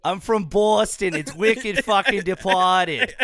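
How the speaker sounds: slow, loud, hammed-up Boston accent. Shown as a demonstration. Host shows off his impression to the band, hammed-up Boston accent. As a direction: lay on a thick, drawn-out Boston accent. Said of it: "ready for my Boston".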